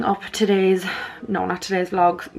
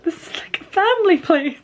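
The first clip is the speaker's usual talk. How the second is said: mock prim; 12 semitones higher than usual. Real, not acted.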